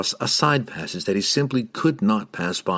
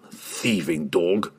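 grim chuckle; 3 semitones lower, 3 dB quieter, much slower than usual. A narrator's reading. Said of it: "Bruner chuckled, with grim satisfaction".

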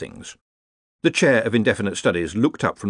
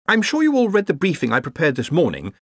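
higher, brightly voiced briefing; 8 semitones higher than usual. A narrator's reading. Ponder reminds colleagues of his briefing, brightly. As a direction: chirp it with mild brightness. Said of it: "said Ponder brightly".

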